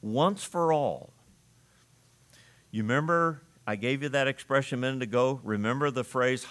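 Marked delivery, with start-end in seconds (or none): 0.0-1.1 "Once for all" said slowly, each word weighted; slow emphatic. Shown as a demonstration. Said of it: "There's an emphasis there".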